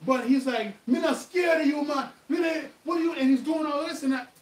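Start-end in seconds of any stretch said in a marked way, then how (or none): 0.9-3.1 high, loud Jamaican yell; Jamaican accent shout. Retold or imitated. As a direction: bark it in a strong Jamaican accent, picking a fight. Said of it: "this guy's mouthing off to me".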